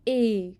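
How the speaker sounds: ए said as a closed é with spread, smiling lips; French é. Shown as a demonstration. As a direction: keep the mouth only slightly open for ए. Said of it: "आपने मुंह बहुत ज़्यादा नहीं खोलना".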